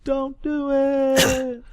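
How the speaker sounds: a mock-ominous wail, very slow; high drawn-out singsong. Teasing the co-host with a long high singsong warning.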